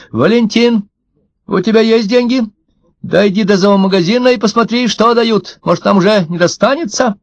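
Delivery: high-pitched wife calling down to her husband. A narrator's reading. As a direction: call down from an upstairs window. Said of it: "крикнула к отцу жена с второго этажа".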